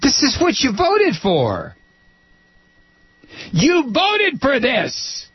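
mock them with scorn. Real, not acted. Host mocking Kentucky voters.

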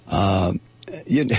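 ordinary talk, nothing marked

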